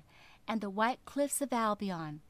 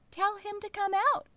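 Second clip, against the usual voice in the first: high, louder squeaky fish voice; little fish voice; 10 semitones higher, 4 dB louder, faster than usual. Acted. Little fish flatters the whale, high and piping. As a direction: pipe up like a tiny clever fish.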